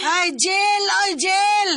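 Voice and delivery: scornful correcting shout; mocking shout. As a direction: yell the word jail at her. Correcting her rival mockingly.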